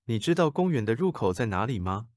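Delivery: ordinary delivery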